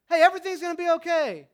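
loud, high sarcastic parody of reassurance; dismissive mock comfort. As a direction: mimic the empty "it's fine" line. Mocking Jesus' comfort line.

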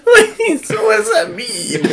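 high pitched laughing through words; can barely talk for laughing. Real, not acted. Laughing at friend's call.

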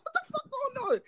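very high-pitched disbelief; squealed incredulous question; retold bewilderment. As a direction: reenact total confusion, voice way up. Retold bewildered reaction to coworker.

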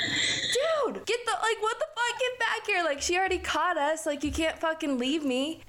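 high-pitched frantic mock plea; panicked calling. Friend abandoned at the window calls runaway back, panicked. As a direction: call back a deserting friend, voice way up.

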